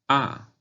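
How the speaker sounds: "a" high and falling; fourth tone. Shown as a demonstration. Teacher practising tones on syllable A, falling "a". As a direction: drop "a" sharply from the top. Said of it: "vamos a practicar los tonos".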